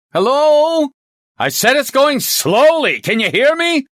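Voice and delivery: high, loud phone shout. Acted. Inspector repeating himself to a caller who can't hear, shouting.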